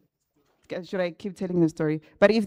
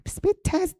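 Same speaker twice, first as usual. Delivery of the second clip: high, startled echo of the question; shocked repeat; surprised; 13 semitones higher than usual. Retold or imitated. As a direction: squeak it back in disbelief. Shocked at the speed test.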